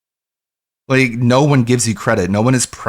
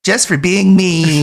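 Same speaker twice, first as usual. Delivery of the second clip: higher, louder, slow mock; mocking echo; 7 semitones higher, 4 dB louder, slower than usual. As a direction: repeat it with a sneer.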